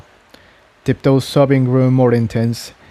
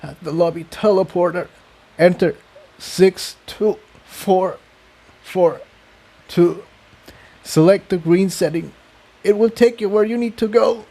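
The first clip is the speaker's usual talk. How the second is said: high, strained, halting voice; weak high voice, pausing between numbers; dying pony's last words; 7 semitones higher than usual. A narrator's reading. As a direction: get the code out with the last breath. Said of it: "she coughed".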